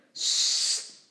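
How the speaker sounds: loud, urgent shush. A narrator's reading. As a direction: hush a crying child. Sister shushing the crying brother.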